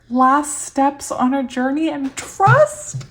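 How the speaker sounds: slow, high, loud disbelief, rising at the end; amazed reading. Real, not acted. Reader finds the card matches her feeling, amazed. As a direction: read it out astonished, stretching up into a question.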